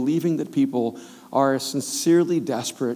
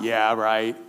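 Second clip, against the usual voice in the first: sarcastic doubt; 4 semitones lower than usual. Retold or imitated. Doubting thought.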